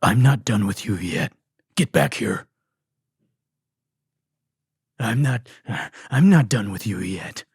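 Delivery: low, loud dramatic villain threat; anime villain. Retold or imitated. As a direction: growl it like an anime bad guy. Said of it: "He's talking like an anime villain".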